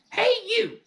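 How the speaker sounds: put-on cranky old woman's shrill yell; grandma yelling. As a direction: screech down from the rooftop. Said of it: "she yelled".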